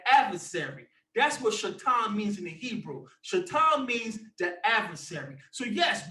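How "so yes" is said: normal talking voice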